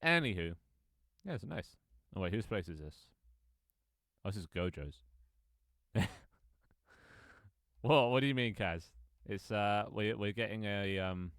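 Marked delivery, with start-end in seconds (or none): none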